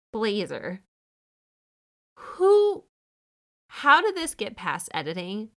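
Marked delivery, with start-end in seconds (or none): 2.2-5.5 a high, loud startled "Who"; shocked outburst. Real, not acted. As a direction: blurt it out, aghast at the outfit. Shocked by the outfit.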